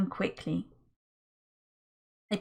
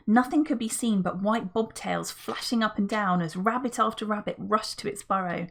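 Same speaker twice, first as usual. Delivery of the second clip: ordinary delivery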